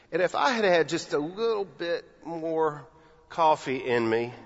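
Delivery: ordinary talk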